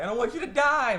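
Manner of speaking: high, loud mock-tearful wail; imitated crying plea. Recapping her tearful plea to the butler, mock crying. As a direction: plead as if crying, half-acted. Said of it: "she starts crying and stuff like".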